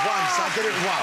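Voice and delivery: high-pitched, loud defensive shout; real angry outburst. Real, not acted. Panellist defending himself to the others, angrily shouted. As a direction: protest hotly, voice shooting up. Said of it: "I got angry".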